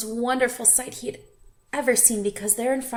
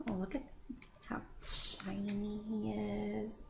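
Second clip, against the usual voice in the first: admiring; 12 dB quieter than usual. Real, not acted. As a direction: hush in wonder at the picture. Reader admiring shiny fish.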